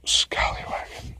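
breathy whisper, slow; whispered word. Real, not acted. Alone in the car, a slow whisper. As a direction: whisper the strange word to yourself.

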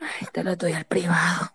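breathy unvoiced whisper, half crying. Real, not acted. Answering the host's joke, whispered on an unvoiced breath. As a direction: breathe it out with no voice, teasing. Asked for moans, replies coyly.

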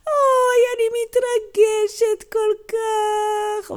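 high, slow mock wail; overcome grandma. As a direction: open on a loud "oy", then draw out every word.

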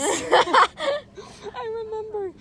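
loud burst of laughter; laughing. Real, not acted. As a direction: laugh through the words. Laughs remembering being sadness.

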